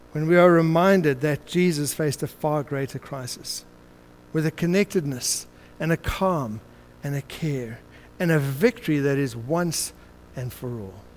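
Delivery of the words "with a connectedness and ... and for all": fading close. Acted. Sermon fades to close.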